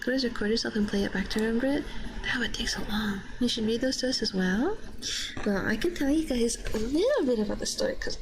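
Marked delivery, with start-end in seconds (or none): none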